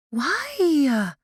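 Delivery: high, exasperated why. Acted. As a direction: throw up your hands in exasperation. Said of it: "Lily asked in exasperation".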